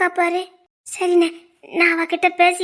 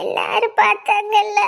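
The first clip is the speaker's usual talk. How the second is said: slow, tearful complaint; crying; 3 semitones higher than usual. Acted. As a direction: drag the words out through sobs. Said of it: "நீ அழாதடி".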